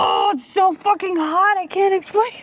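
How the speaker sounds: high, loud cry of pain; pained yelling. Real, not acted. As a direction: yell as the heat hits. Mouth burning from hot pepper.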